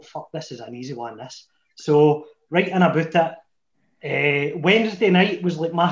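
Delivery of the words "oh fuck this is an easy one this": muttered under his breath, softer and quick; muttered inner thought. Retold or imitated. Recalling his thoughts on the date, quickly muttered aside. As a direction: mutter it to yourself, quick and hushed.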